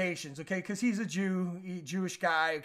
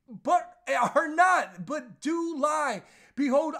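preaching scripture; 4 semitones higher than usual. Real, not acted. Quoting scripture with emphasis.

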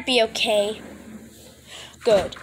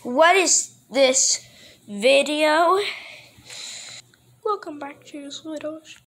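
normal talking voice

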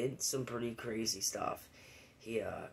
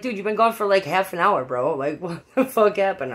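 high, loud, laughing disbelief; incredulous friend; 8 semitones higher, 13 dB louder than usual. Retold or imitated. Friend asking where I went for half an hour, loud laughing disbelief. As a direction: friend can't believe it, laughing.

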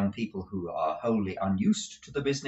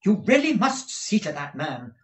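high, louder complaint; exasperated wife; 10 semitones higher, 5 dB louder than usual. Acted. Wife complaining to husband about servant, high exasperated voice. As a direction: press the complaint in a raised voice.

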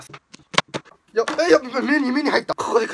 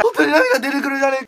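teary disbelief; 3 semitones higher, much slower than usual. Real, not acted. Eyes streaming from cut onion.

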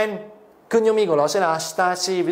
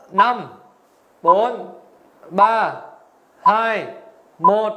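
louder, evenly spaced countdown; countdown call; 2 semitones higher, 3 dB louder, much slower than usual. Pretend. Teacher counts down the quiz clock for viewers, loud countdown. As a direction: punch out each number, pausing between.

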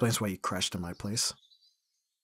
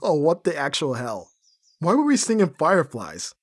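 louder, much higher incredulous outburst; shocked disbelief; 6 semitones higher, 8 dB louder than usual. Acted. Watching their drunk karaoke video, loud shocked disbelief. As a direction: react like you can't believe the video.

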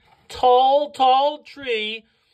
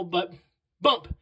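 ordinary delivery, nothing marked

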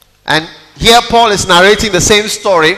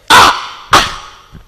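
mock gasp. Imitating slain man.

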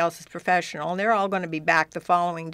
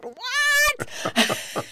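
high, squeaked mock disbelief; a stunned director's high yelp; shocked what. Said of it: "And he said".

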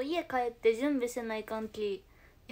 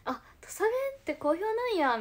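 high-pitched Tosa-dialect surprise; surprised realisation; 10 semitones higher than usual. Retold or imitated. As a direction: react as if pleasantly caught off guard. 方言が好評と知った驚きの再現. (意外そうに驚いて)

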